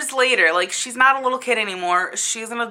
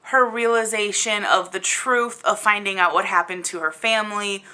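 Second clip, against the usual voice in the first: usual speaking voice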